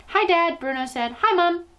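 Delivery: put-on high, bright little-boy voice; cheery boy mouse. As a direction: chirp it like a small boy. Boy mouse greets his parents.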